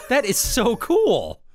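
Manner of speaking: mock awe. Mock awe at curious monkey.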